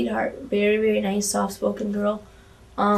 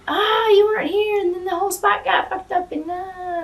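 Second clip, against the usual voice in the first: flustered mock panic; 10 semitones higher than usual. Wrestler retells botching her spot, high mock panic. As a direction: act out a nervous high wail.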